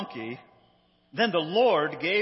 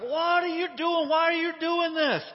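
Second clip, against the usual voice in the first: high, raised mock-angry cry; exasperated rider; 12 semitones higher, 4 dB louder than usual. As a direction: scold the donkey in hammed-up disbelief. Acting Balaam fed up with donkey.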